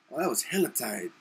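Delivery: half-hearted imitation of Oakland street talk; street slang accent. Shown as a demonstration.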